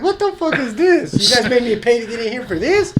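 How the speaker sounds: very high-pitched incredulous squeal; hammed-up cheated customer's complaint; mock outraged protest. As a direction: play up the indignation, voice way up. Retells protest at being kicked out.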